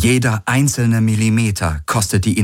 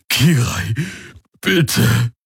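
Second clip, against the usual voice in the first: higher, weak plea; pleading; 5 semitones higher, much slower than usual. A narrator's reading. Wounded Aur pleads with Kirai, weak and higher. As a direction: beg for help, hurting.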